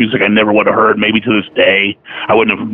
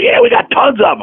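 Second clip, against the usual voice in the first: shop lady voice. Retold or imitated.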